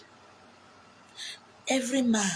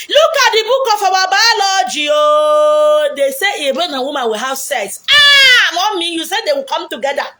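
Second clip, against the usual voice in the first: high, loud, laughing protest; laughing outrage; 7 semitones higher, 9 dB louder than usual. Retold or imitated. Children confronting mother about biology book, loud laughing protest. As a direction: laugh and cry out like caught-out kids.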